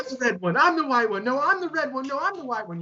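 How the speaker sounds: high-pitched mock childhood argument; imitating kids fighting over who's who; squabbling kids. Kids squabbling over Ranger roles, acted.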